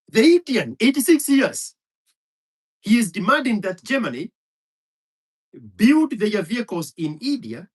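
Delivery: loud and high, hammering each point; fired-up ranting; heated shout. Real, not acted. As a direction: drive it home angrily. Heated rant building argument.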